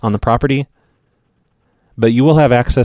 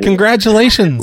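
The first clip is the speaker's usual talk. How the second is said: excited; 10 semitones higher than usual. Acted. Prank caller congratulating winner.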